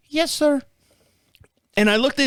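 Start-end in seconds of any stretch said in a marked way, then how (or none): 0.1-0.6 put-on high, girlish rep voice; little girl on phone. Bank rep asking caller to verify, high little-girl voice. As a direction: rattle off verification in a girlish pitch. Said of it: "I get this little girl on the phone".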